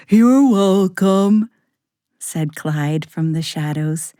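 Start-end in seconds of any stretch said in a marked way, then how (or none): 0.1-1.5 loud, slow monster voice. Acted. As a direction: play the monster, pleased and friendly. Monster Clyde replies.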